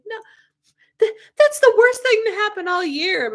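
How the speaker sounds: high, loud mock lament; hammed-up wail of disbelief; dramatic despair. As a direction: overplay the worst news of the year. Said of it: "I was like".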